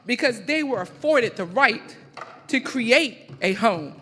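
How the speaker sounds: loud, emphatic live poetry recital with measured pauses; spoken-word poem. Acted. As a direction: declaim it line by line, letting each phrase land.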